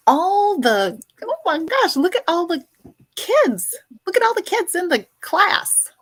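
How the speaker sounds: high, loud playful teacher voice, a hint of schoolroom; mock teacher. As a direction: pretend to greet a class of little kids. Mock teacher greeting stream as class.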